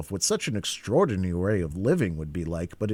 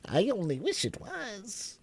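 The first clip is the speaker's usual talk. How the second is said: soft, high March Hare aside; whispering; 13 semitones higher, 5 dB quieter than usual. A narrator's reading. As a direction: murmur it to yourself. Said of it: "said to itself in a whisper".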